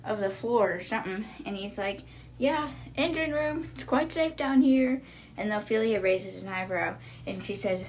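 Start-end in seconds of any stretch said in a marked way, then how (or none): 2.4-5.0 higher, echoing up from below; reverberating voice. Retold or imitated. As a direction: call up from the engine room far below. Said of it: "his voice is, like, reverberating through the bottom".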